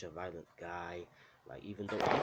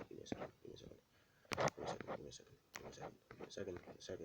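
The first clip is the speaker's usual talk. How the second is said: whispered to self; 2 semitones lower, 7 dB quieter, faster than usual. Real, not acted. Muttering while searching for picture.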